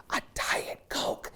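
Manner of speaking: slow, soft whisper, like a guilty secret; hushed confession. Real, not acted. Speaker confessing a drink order to a judging audience, hushed whisper. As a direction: whisper it, drawn out. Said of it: "can I say it?".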